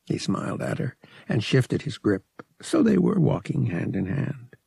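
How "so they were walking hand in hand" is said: ordinary delivery, nothing marked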